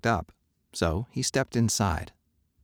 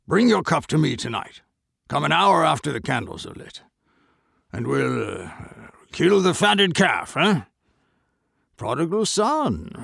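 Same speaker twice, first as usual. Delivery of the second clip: sly merchant; 7 semitones higher, 3 dB louder than usual. Acted. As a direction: invite him with hidden malice. Merchant schemes.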